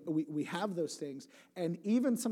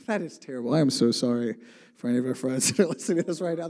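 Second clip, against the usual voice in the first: loud, laughing through the words; laughing apology; 2 semitones lower, 10 dB louder, faster than usual. Real, not acted. Apologising to Russian friends for the bad accent, laughing. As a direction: apologise while cracking up.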